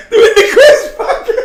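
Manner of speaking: high, loud, squeezed through laughter; laughing punchline. Retold or imitated. Telling the listener the prank's punchline, laughing hard. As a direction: deliver the punchline while cracking up.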